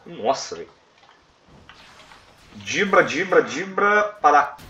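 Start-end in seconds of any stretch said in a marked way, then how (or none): 2.6-4.6 loud, high urgent dodge calls; excited. Real, not acted. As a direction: shout at the boss to stop. Dodging boss attacks.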